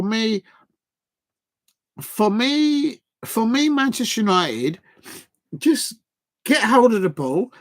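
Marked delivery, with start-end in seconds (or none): none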